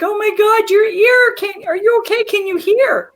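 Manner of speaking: put-on panicked kids asking about the ear; kids crying out. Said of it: "And they were crying".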